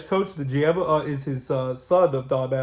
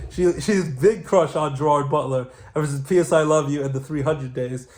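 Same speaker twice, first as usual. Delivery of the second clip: ordinary talk, nothing marked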